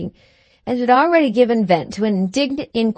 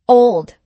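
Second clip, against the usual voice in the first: one short word, hit hard and loud; brief, emphatic; 4 semitones higher, 7 dB louder than usual. A narrator's reading. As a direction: bite off Old, loud and curt. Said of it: "briefly but emphatically".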